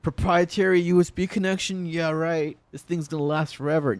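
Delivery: mild mocking of his past faith; sarcastic. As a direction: sneer it as if you once believed it. Sarcasm about the Zune.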